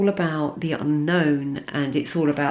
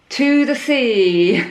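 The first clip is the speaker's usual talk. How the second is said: delighted, drawn out and high; excited; 6 semitones higher, 6 dB louder, much slower than usual. Real, not acted. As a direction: savour the card name with glee. Reading card title excitedly.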